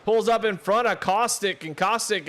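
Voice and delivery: sudden jump to a high, loud excited call. Real, not acted. Commentator reacting to a surprise pass, excited call. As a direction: react to the move on track with a burst of excitement.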